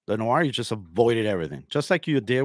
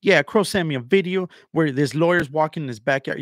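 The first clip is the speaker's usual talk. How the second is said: higher mock whining quote; mocking imitation; 6 semitones higher, 4 dB louder than usual. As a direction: mimic his repeated claim in a raised voice. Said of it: "he keeps bringing it up like".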